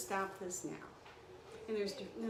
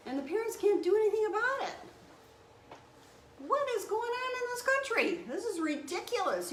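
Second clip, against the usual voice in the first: much higher and louder, fast indignant outcry; outraged outburst; 11 semitones higher, 5 dB louder than usual. Real, not acted. Nurse ranting to viewers about kids taken from school, outraged, much higher and louder. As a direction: let the outrage burst out, pitch flying up. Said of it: "I got on this tyrant".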